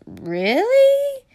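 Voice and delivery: high, surprised question. Acted. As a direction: gasp it out in disbelief. Idaho surprised.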